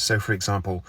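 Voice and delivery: ordinary talk, nothing marked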